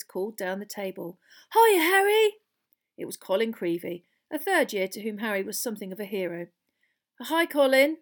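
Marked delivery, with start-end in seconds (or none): 1.5-2.4 loud, high, breathless fan's call; highly excited. Acted. Small boy hailing his hero, highly excited. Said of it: "a highly excited, breathless voice". 7.2-8.0 raised, louder, guarded hello; wary greeting. Acted. Boy answering his eager admirer, warily. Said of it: "said Harry warily".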